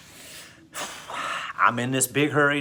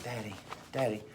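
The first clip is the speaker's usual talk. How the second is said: put-on small, very soft tearful little-boy plea; a hint of a crying kid tugging at dad; teary child; 12 dB quieter than usual. Son tugging dad's pants leg, softly teary. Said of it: "great big old crocodile tears".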